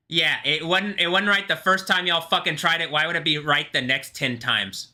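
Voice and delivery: exasperated rant. Real, not acted. Speaker ranting at y'all for retrying, exasperated. Heated rant at retried attempts.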